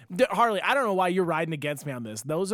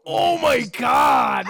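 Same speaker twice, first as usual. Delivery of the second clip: slow, high, loud mock gasp; fake surprise shout; 8 semitones higher, 8 dB louder, much slower than usual. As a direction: overact the shock of the scene. Faking surprise at the show.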